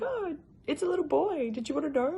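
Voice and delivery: lifted, high-pitched imitation of delivery staff; retold announcement. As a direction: lightly act out breaking the baby news.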